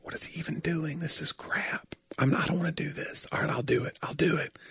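hushed, whiny griping; mostly whispered mock complaining; muttered complaint. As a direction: grumble it under your breath. Retold band member griping.

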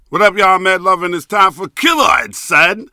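booming radio intro; hyped announcer intro. Real, not acted. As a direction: open the show like a hype man. Show intro, announcer hype.

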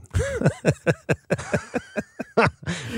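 long burst of laughter; a real, sustained laugh; hearty laugh. Real, not acted. Laughs after admitting he's upset.

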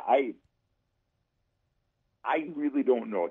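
ordinary delivery

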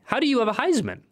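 high, incredulous question; genuinely baffled, pitch jumping up; real disbelief. Real, not acted. Hearing he owns a Heisman.

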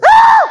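sudden piercing high scream; imitated child's scream. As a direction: shriek like a kid in pain. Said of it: "that same kid goes".

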